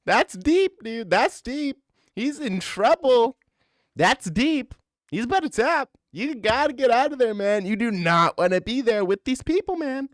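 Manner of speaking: high, loud mock Rogan voice; Joe Rogan bit. Joe Rogan from the helicopter, high mock rant. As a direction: keep the squeaky Rogan rant going.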